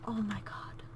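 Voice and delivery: soft unvoiced whisper; whispered awe. Real, not acted. Whispering so the sleeping baby stays asleep.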